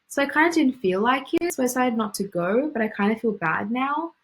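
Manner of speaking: each phrase ending on "kinda didn't feel like it", "not to go", "feel bad now" with a clear upward lift; rising upward phrases. Shown as a demonstration. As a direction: end every clause on a rising Aussie tune. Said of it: "That rising intonation, that high rising terminal".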